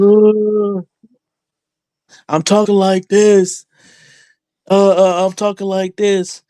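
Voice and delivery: high, loud, slow cartoon impression. Mocking a rival wrestler's high cartoon voice. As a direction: squeak it out like a cartoon character. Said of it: "that sounds like a cartoon".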